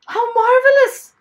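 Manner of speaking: very high, loud burst of delight; delighted exclamation. A narrator's reading. Airplane man hearing of the dragon fight, delighted cry. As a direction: exclaim in thrilled admiration.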